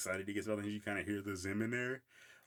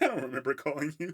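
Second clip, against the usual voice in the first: cartoon voice cracking up; high, loud, laughing mock voice; laughing through a quote; 6 semitones higher, 4 dB louder than usual. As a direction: imitate while laughing. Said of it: "It's like".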